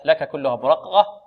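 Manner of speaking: ordinary talk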